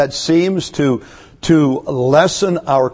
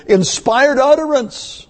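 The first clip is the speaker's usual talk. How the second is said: lofty; 10 semitones higher than usual. Real, not acted. Preacher exalts Paul's oratory.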